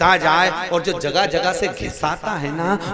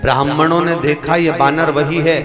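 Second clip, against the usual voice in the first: full-force preaching; 9 dB louder than usual. Acted. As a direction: preach it out to the hall.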